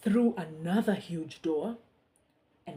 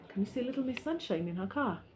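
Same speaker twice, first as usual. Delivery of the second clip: plain reading voice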